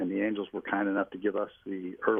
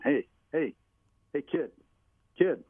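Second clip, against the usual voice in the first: higher-pitched imitation of calling out; retold yelling; 6 semitones higher, 3 dB louder than usual. Manager yelling after the pitcher who beat them, retold as calling out. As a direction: mimic hollering after someone to get their attention. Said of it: "Ned starts yelling at him".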